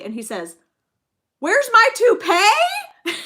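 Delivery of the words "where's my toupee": laughing punchline. Retold or imitated. Old man's punchline, laughing.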